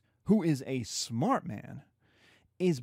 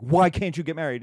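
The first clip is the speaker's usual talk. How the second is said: louder, hammed-up father demanding; mock angry demand; 8 dB louder than usual. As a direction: bark the question at her. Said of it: "And he's like".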